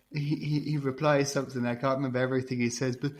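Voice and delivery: ordinary talk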